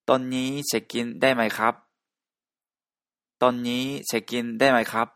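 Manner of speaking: fast, clear Thai example sentence; Thai phrase demo. Shown as a demonstration. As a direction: say ตอนนี้เช็คอินได้ไหมครับ as a model phrase in Thai. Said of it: "っていう言葉です". (タイ語のお手本発音)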